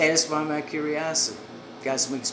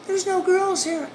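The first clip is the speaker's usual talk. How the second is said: woman behind the door; 5 dB louder than usual. Retold or imitated. Woman answers through door.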